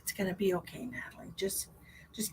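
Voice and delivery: whispered, fading to a hush on the name; hushed tender consolation; soft comforting whisper. Real, not acted. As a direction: whisper reassurance to a hurting friend. Comforting viewer Natalie.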